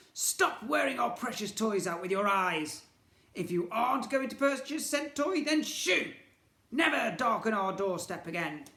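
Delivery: put-on high, loud sneering old men; nasty scolding. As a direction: scold the boy to shoo him off. Nasty twins scolding Ned.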